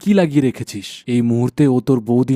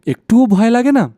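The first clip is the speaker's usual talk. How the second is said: high, louder and tense, asking if she isn't scared; anxious question; 11 semitones higher, 6 dB louder than usual. Acted. Husband to wife about the witch, high and anxious. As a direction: press her with worried disbelief.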